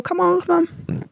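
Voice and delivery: lightly mimicked call for the dog; sing-song call to a dog out of earshot. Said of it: "I was banging his bowl".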